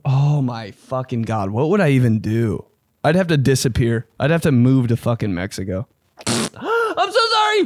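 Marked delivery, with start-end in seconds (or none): 6.2-7.7 very high, slow, tiny mortified voice; squeaky apology. Pretend. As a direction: squeak it out in total embarrassment. Acted apology after fart.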